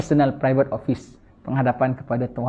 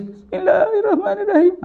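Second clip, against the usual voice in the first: much higher, hammed-up weeping voice; mock fake crying. Preacher mocking a pretend-crying worshipper, hammed-up sob. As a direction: fake tearful wail, laughing through it. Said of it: "Bukan buat-buat nangis".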